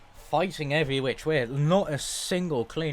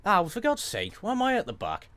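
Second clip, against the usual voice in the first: exasperated surprise; 6 semitones higher than usual. Real, not acted. Finds himself starting last.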